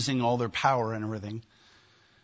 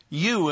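"You" hit hard and louder; emphatic You; 3 semitones higher, 3 dB louder than usual. Shown as a demonstration. Preacher shows the congregation where the emphasis falls, emphatic. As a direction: punch the single word "You". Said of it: "emphatic, put in a place of emphasis".

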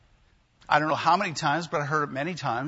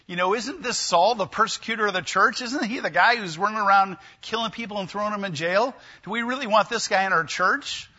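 raised, suspicious questioning, louder; suspicious crowd; 5 semitones higher, 5 dB louder than usual. Retold or imitated. Synagogue members suspicious of Saul, raised alarmed questioning. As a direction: voice the wary congregation doubting him.

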